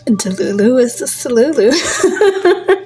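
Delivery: real giggles spilling out after the line; laughing. Real, not acted. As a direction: crack yourself up saying it. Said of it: "Sorry if I'm laughing so much".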